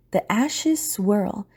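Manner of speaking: ordinary talk, nothing marked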